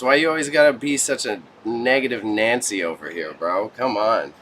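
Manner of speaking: normal talking voice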